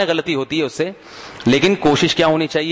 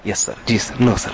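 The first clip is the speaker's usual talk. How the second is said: lightly voiced subordinate; 4 semitones lower than usual. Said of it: "लेकिन मातहत".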